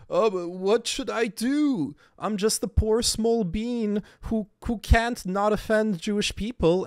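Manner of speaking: high, slow, whiny mock voice. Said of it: "where [name] is like".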